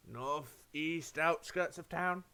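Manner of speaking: very slow, drawn-out directions; the old shopkeeper pointing the way, slow and deliberate. Acted. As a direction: stretch each word as if recalling. NPC giving directions.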